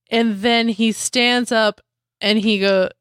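normal talking voice

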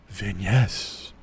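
very low, hushed announcement; deep, quiet mock-portentous murmur; mock mysterious reveal. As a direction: drop low and soft to name the street. Mocking friend's mysterious street name.

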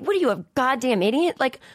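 high, incredulous outburst; exasperated rant. Real, not acted. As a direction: scoff at it, fed up. Ranting about sleep.

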